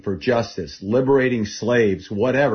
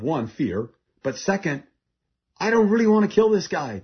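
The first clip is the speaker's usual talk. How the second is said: usual speaking voice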